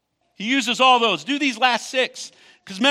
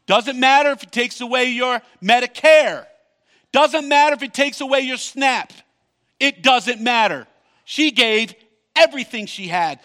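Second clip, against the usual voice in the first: fiery preaching; 4 semitones higher, 5 dB louder than usual. Real, not acted. Preacher drives point home.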